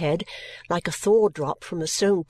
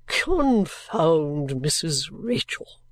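low, very slow, drawn-out curse; indignant gentleman; 4 semitones lower, much slower than usual. Acted. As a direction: stretch the curse out as an eccentric gentleman. Gentleman curses absent woman.

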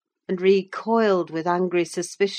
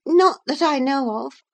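stammering; 10 semitones higher, 6 dB louder than usual. A narrator's reading. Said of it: "stammered Miss".

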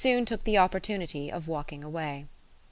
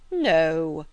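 firm exclaimed objection, only lightly raised; mild cry; 2 semitones lower, 4 dB louder than usual. A narrator's reading. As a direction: cry out a refusal, restrained. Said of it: "cried Mister Knightley".

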